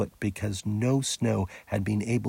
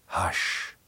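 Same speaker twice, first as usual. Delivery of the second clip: breathy whisper of "Hush"; the beaver hushing the children in a whisper. Acted. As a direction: shush them in a whisper. The beaver signals them to be quiet.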